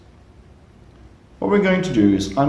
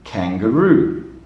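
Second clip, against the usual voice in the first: slow model word; slower than usual. Shown as a demonstration. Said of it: "One more time".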